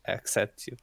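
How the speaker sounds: half-hearted accept you, trailing down; grudging, quiet. Acted. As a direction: accept you mumbled as a hesitant concession. Grudging acceptance after hesitation.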